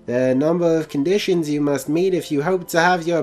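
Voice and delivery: theatrical professor with a put-on accent; professor character voice. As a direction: instruct young trainers in a high character voice.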